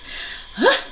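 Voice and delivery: high gasping breaths after an outburst; exaggerated panting sighs. Vlogger finishing stressed retort to critics, hammed-up gasping. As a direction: huff in mock exhaustion.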